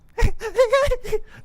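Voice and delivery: put-on high creepy child's giggle; haunted little kid laughing; kid giggling. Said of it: "best impression of a child's laughter".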